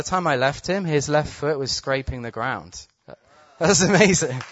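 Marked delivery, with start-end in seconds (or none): 3.6-4.4 high, loud, thrilled exclamation; amazed. Real, not acted. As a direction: burst out in wonder. Marvelling at the healing.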